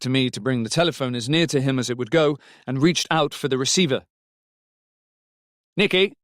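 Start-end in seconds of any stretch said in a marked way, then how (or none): 5.8-6.2 put-on calling to a child. A narrator's reading. Calling child over telephone.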